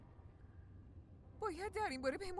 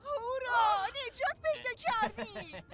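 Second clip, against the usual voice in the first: loud, high joyful hooray; cheering; 4 semitones higher, 7 dB louder, slower than usual. Acted. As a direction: cheer like you've been rescued. Cheering the found dog.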